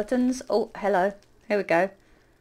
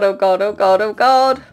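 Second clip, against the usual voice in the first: ordinary talk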